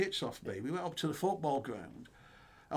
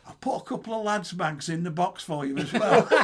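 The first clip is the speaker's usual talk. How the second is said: laughing; 9 semitones higher, 11 dB louder than usual. Retold or imitated. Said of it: "He said".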